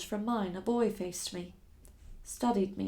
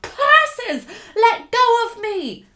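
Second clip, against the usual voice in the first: loud, much higher indignant cry; calling out; 13 semitones higher, 12 dB louder, much slower than usual. Acted. Struggling captive crying out at her captor, loud and high. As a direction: yell at him to let go. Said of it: "I continued to call out".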